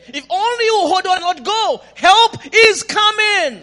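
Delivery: high, loud, slowing preacher's cry; preaching shout. Real, not acted. Preacher shouting the promise to the congregation. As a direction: drive it home at full voice, stretching the end.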